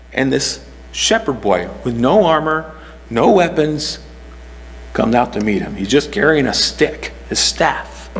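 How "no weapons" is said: ordinary delivery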